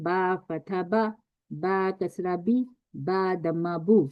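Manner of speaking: slow बा फतह बा, बा कसरा बी, बा दम्मा बू; vowel drill. Shown as a demonstration. Teacher drills the short vowels, slow. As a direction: read each vowel slowly and clearly. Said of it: "इसको देखें".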